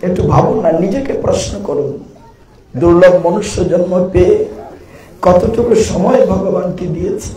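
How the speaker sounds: ordinary delivery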